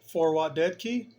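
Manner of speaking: normal talking voice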